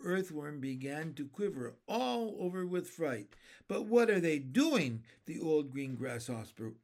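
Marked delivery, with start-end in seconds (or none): none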